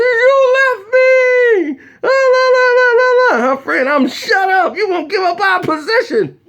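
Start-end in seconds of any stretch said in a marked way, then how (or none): 0.0-3.6 mock wailing girlfriend. Mimics cousin's girl left behind. 3.9-6.4 high-pitched imitation of an exasperated friend; high mock-frantic shushing; mock scolding friend. Mimics her friend hushing her.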